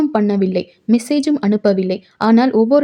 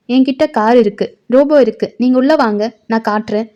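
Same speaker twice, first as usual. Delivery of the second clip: usual speaking voice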